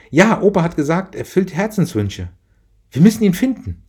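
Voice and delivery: put-on a child sure of a wish coming true; excited little girl. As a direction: daughter urging mother to find the Rebevater, excited child. Child Franzi voiced in story.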